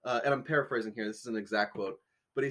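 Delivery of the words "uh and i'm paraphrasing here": ordinary talk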